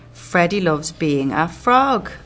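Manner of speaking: lively read-aloud; reading to children. Acted. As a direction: read it out to the class. Reads the back cover.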